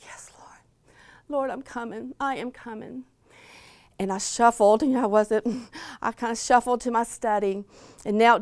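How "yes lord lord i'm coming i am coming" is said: a bare whisper, rising to soft voiced words; surrendering in a hush; very quietly. Broken woman answering God, very quiet whisper. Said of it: "I, very quietly, I said".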